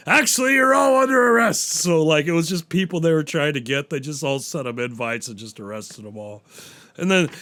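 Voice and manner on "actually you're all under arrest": raised, smug cop reveal; spring the trap on them like a smug cop; mock Pacino announcing the arrest, pitched up; cop's gotcha line. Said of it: "Al Pacino's like".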